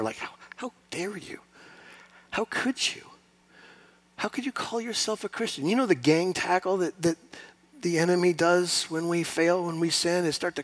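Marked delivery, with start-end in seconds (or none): 0.2-5.7 soft hissed accusing whisper; Satan whispering. Acted. Preacher voicing Satan whispering accusations to the sinner. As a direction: whisper like the devil in a sinner's ear. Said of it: "He whispers in the ear of this sinner".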